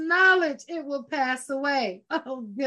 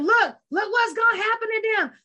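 mock gleeful taunt, pitched higher; gloating scream; 6 semitones higher than usual. Preacher mocks a gloating crowd, hammed-up taunt. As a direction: jeer at their coming ruin. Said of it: "It's not saying and screaming".

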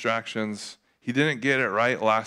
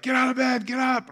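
imitated yelling wife; 12 semitones higher than usual. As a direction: imitate an upset wife's high cry. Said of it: "she was yelling, crying".

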